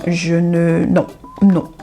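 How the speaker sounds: ordinary talk, nothing marked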